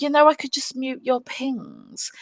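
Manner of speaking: whispered threat. Retold or imitated. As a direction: hush it like a sly aside. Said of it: "And then I was like".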